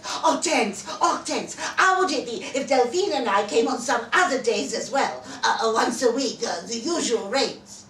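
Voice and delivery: eager, tumbling out the request; in great excitement. Acted. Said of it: "he phoned me in great excitement".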